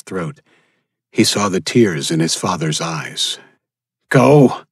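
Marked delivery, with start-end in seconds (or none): 4.1-4.6 a loud, higher shout of go; yelled. Acted. Father yells at his son to go, loud. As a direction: force the boy away with one shout. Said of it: "his father yelled".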